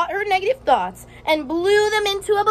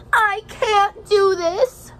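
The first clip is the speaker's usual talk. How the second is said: slow frustrated complaint; 6 semitones higher, much slower than usual. A narrator's reading. Teacher voicing the ninja, slow higher whine. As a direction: drag it out like a discouraged kid.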